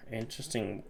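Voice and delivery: softly muttered Interesting; quiet. Real, not acted. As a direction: drop the word Interesting to a murmur. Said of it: "second take much softer".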